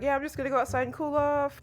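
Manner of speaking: high, fast flustered brush-off; flustered Yor. Retold or imitated. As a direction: hurry the excuse out, lightly flustered. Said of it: "and she's like".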